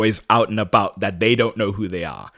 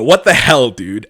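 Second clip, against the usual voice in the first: sudden loud what the hell then a dropped dude; rounding on him, a burst of anger; retold outrage; 7 dB louder than usual. [name] rounds on Trip in mock anger. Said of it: "round on Trip and go".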